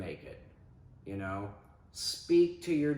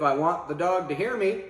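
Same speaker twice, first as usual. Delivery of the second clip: firm, louder command voice; loud and authoritative; 7 semitones higher, 7 dB louder than usual. Shown as a demonstration. Trainer projecting so the dog hears, loud and firm. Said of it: "Those commands are all loud and authoritative".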